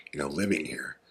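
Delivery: soft whispered warning; whispered aside. Real, not acted. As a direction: whisper it so no one hears. Fearful aside about local danger.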